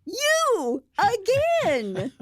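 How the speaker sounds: high, drawn-out mock-outraged cry; exasperated outburst. Fred spotting the fridge raider, hammed-up outrage. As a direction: stretch each word out, high and indignant. Fred spots the food thief, acted.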